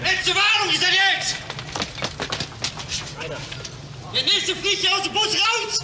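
roaring threats in wild rage; berserk rage. Real, not acted. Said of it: "Das Gebrüll des Verbrechers".

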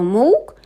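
loud rising moo-ish call; a strange bleat from below; creature call. A narrator's reading. Creature under the bed calling out, loud.

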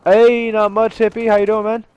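loud, high, upbeat hail; hearty greeting. Real, not acted. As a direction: greet a buddy at full volume. Calling out a greeting to friend.

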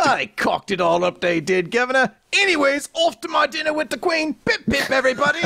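a toff off to dine with the Queen; posh British accent. Pretend. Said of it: "My accent's changing with every scene".

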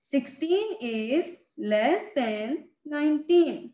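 slow, spaced-out reading of the comparison; slow reading aloud. Shown as a demonstration. Teacher showing students how to read it, slowly. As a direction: say it slowly so children can follow. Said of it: "अब हम इसको किस तरीके से पढ़ेंगे".

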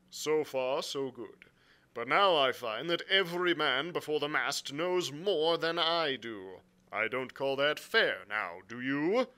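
lightly voiced frank captain; blunt sea captain. Captain answering the angry squire, bluntly. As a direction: answer with a touch of stiff formality. Captain's dialogue beside narration.

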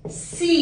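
"सी" with a long ee; syllable see. Shown as a demonstration. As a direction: stretch the see sound. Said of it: "The sound should be सी".

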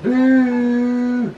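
long, high held call; sustained high-pitched summons. Real, not acted. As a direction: call the pet's name on one high, stretched note. Calling for the lost monkey.

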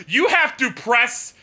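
high, slow, loud outraged shout; exasperated rant. Real, not acted. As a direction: hammer out each word in disbelief. Ranting about tedious saving.

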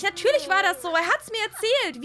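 high, loud snapping back; angry retort. Acted. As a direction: shut her down, furious. Sisters' acted quarrel.

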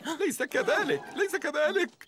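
high, loud flustered insisting; villain's panicky insistence; high protest. Acted. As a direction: protest shrilly that it's nothing. Villain insists it's only a triangle.

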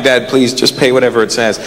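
low, mildly embarrassed plea to dad; understated begging from the back seat; pleading son. Retold or imitated. Son begs dad to just pay, mildly pleading. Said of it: "I'm in the back, I'm like".

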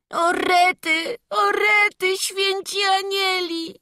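high, drawn-out blubbering lament; acted wailing. Boy bawls up on the altar, wailing. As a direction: wail slowly like a bawling boy. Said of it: "stoi ano jeszcze na górze i beczy".

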